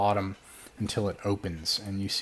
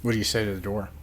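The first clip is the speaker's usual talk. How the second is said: usual speaking voice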